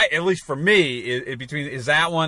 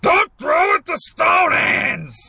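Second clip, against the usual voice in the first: coach yelling; 11 semitones higher, slower than usual. Retold or imitated. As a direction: bark it across the field at a player. Quoting a coach character.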